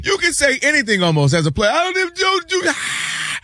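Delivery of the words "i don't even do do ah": high mocking voice of a dismissive owner. Mocking the owner's dismissive speech at the parade, high sneering voice. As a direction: sneer it out, much higher, trailing off.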